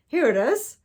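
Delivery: high, loud excited shout; excited cry. Acted. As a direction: call out the discovery, thrilled. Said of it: "he cried".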